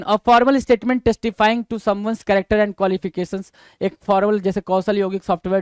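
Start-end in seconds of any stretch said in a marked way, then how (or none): 0.0-3.5 reading the definition out slowly; slow dictation. Shown as a demonstration. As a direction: read it slowly so students can note it down. (धीमी आवाज़ में परिभाषा पढ़ना)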